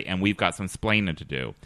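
usual speaking voice

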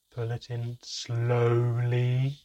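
drawn-out, exaggeratedly slow, sing-song reply; eye-rolling. A narrator's reading. Son answering father's repeated question, eye-rolling and drawn out. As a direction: stretch it out like a bored, sarcastic kid. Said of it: "rolling my eyes as I spoke".

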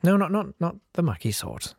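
normal talking voice

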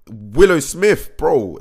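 high, loud, slow hyped name drop; excited. Real, not acted. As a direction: draw the name out, thrilled.

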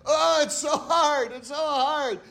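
high, loud, drawn-out lament; hammed-up moaning under a heavy load; mock complaining. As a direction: imitate an overburdened complainer. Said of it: "they're lugging the mitzvot".

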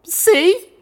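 very high, loud delighted squeal; gleeful crazed delight; delighted giggly. A narrator's reading. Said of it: "She gave a delighted giggle".

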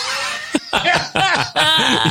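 high burst of laughter. Real, not acted. Laughs at being interrupted.

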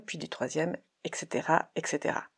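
usual speaking voice

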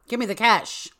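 loud, high demand for cash; hammed-up robber ordering the cashier; playful retold yell; mock robber shout. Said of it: "and shouted".

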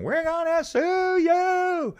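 very high, stretched-out mock shriek; Hannah. Imitating a friend freaking out at the airline desk, mock shriek. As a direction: screech it like a frantic, hammed-up threat. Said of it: "we're freaking out, and, you know, and Hannah's going".

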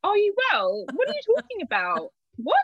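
very high-pitched, baffled questioning; retold incredulity. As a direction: replay total disbelief, voice leaping up. Retold reaction to winning news.

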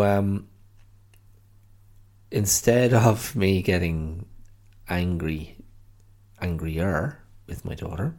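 normal talking voice

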